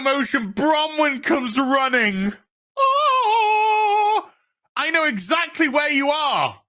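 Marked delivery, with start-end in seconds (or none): 2.8-6.6 high, hammed-up wail into a cry; shrieky parody of a dramatic heroine; mock heroine. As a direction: wail it high like an overwrought rescuer. Mocks Bronwyn running to the rescue.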